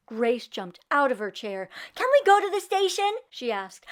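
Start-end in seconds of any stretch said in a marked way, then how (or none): 1.9-3.1 much higher, louder and fast; excited girl begging her father; eager plea. Acted. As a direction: bounce with excitement as you ask. Grace jumps out of chair.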